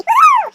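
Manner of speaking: high, loud "woohoo"; squealed cheer; gleeful whoop. Acted. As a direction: whoop with mock delight.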